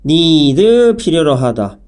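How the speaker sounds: ordinary talk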